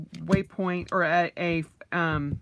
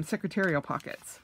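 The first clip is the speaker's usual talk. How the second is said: ordinary talk, nothing marked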